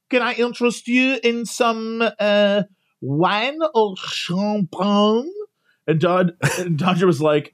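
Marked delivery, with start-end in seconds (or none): 0.1-5.3 imitated attendant. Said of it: "the woman was like".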